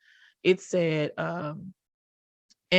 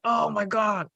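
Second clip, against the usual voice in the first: overwhelmed; 3 semitones higher, slower than usual. Retold or imitated. Worrying about the move, mock-panicked. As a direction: throw it up like it's all too much. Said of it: "I'm like".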